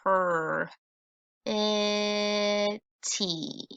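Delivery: slow segmented sounds per-eh-tea; sounding out. Shown as a demonstration. Teacher sounding out a word for kids, slowly by sound. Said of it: "sounding this out".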